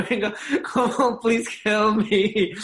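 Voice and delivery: high, slow pleading English; mock desperate plea; pleading tourist. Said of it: "le explicaba en inglés a la guarda". (turista suplicando en inglés)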